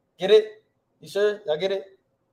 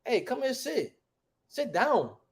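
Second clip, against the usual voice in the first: higher, softer, colloquial summons; casual everyday talk; 7 semitones higher, 5 dB quieter, slower than usual. Shown as a demonstration. Teacher showing how we really talk, casual summons. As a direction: wave someone over in everyday slang. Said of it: "We don't talk like that, man".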